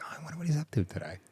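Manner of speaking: soft, quickened musing to oneself; idle wondering aloud, softly; wistful musing. Retold or imitated. Voices own musing thought driving past.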